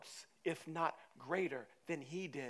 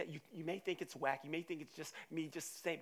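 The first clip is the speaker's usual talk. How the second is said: normal talking voice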